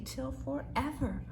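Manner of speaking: ordinary delivery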